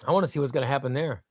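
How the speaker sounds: ordinary talk, nothing marked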